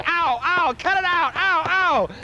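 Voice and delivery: pained cries. Retold or imitated. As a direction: yelp like you're being hit. Policeman beating him up.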